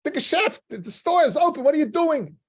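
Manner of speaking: high, loud, frantic shriek at the husband; wife screaming. Retold or imitated. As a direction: scream it like a wife who found him missing from the store. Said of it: "Starts screaming at him".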